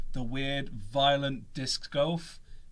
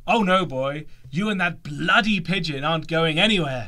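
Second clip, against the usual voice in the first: Vernon voice; 2 semitones higher, 7 dB louder than usual. Acted. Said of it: "Vernon again".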